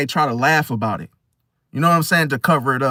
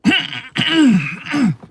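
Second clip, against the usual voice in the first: throat clearing; 8 semitones higher than usual. Shown as a demonstration. Showing a nervous stranger clearing his throat. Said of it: "clearing their throat".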